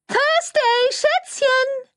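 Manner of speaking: very high, loud shriek of delight; high-pitched shrieking hello; shrieking gushy greeting. A narrator's reading. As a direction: squeal the name like an overexcited publicist. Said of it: "kreischte Cordelia Flack".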